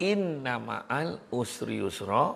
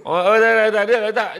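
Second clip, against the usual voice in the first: quick denial; 6 semitones higher, 8 dB louder than usual. Real, not acted. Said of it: "corrects himself loudly".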